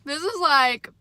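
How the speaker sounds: usual speaking voice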